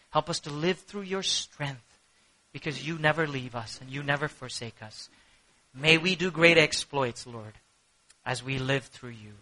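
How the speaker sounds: low, soft spoken prayer. Real, not acted. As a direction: address God quietly and earnestly. Leads closing prayer.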